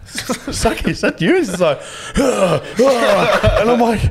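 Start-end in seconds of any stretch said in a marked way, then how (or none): 0.1-1.4 laughing surprise. Retold or imitated. Finds mate hidden on couch. 2.2-3.6 laughing grunt. Retold or imitated. Mate caught on couch.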